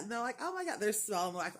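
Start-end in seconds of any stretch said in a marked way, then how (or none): 0.4-0.8 high, gushing imitation; going nuts. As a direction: mimic an excited squeal of delight. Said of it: "they were going nuts".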